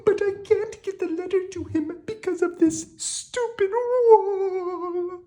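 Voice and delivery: very high, lamenting princess voice; a princess pouring out her sad tale. Acted.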